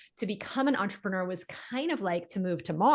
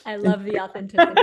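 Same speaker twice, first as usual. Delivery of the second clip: laughing; 10 dB louder, faster than usual. Real, not acted. Reacting warmly with a laugh.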